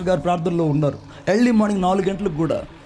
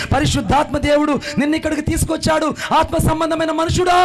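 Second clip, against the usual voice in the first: preaching shout; 7 semitones higher than usual. Real, not acted. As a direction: build it up and shout it out to the crowd.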